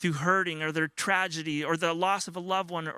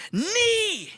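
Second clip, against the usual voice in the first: high, loud mock toddler screech; imitated toddler scream; 6 dB louder than usual. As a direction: screech it like a demanding little boy. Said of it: "he just screams that at you".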